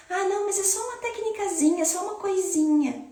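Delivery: high, soft, mock-modest little voice; false humility. Teacher imitating falsely humble talk about her course, high and belittling. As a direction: shrink it away in a small, quick, high voice. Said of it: "nessa falsa humildade que é pregada".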